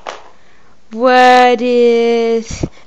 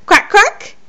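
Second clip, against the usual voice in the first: higher, rising quack, quack; high playful quack; 7 semitones higher than usual. Retold or imitated. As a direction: sing it up like a duck. Duck sound said twice differently.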